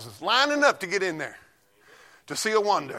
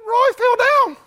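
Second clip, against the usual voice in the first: much higher, louder put-on voice; high punchline voice; 6 dB louder, much slower than usual. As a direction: deliver the punchline in a silly voice.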